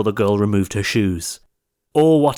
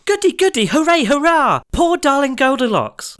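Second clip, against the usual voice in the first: put-on very high, louder child's cheer; children cheering; 5 dB louder than usual. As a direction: squeal it like delighted kids.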